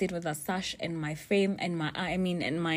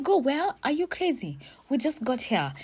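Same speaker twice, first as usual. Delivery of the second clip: much higher, indignant questions; groggy disbelief; 8 semitones higher than usual. Retold or imitated. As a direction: squeak out shocked protest. Woken at 5am, retorts.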